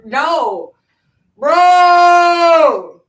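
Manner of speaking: loud, high disgusted yell; disgusted outcry. Real, not acted. Recalling the pig foot pozole, a loud disgusted yell. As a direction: shout it out in horror.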